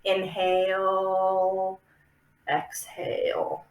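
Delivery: very slow inhale, exhale a soft whisper; drawn-out yoga breath cue. Shown as a demonstration. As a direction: stretch each word like a long breath. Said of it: "same thing with meditation, with yoga".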